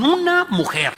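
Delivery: UNA cried out high and loud on "una mujer"; emphatic stress. Acted. Preacher to congregation, stressing "una mujer" high and loud.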